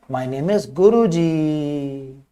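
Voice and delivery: very slow self-introduction; lightly imitated child's introduction; child's full sentence. Shown as a demonstration. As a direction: draw the final vowel out long. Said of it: "क्या बोलेगा".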